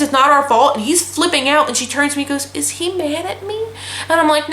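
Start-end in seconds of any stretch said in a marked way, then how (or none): 2.5-3.6 small, high, soft imitated question; mock timid. New girl asks her trainer about angry boss, timid imitation. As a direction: imitate a worried newcomer, hushed and higher.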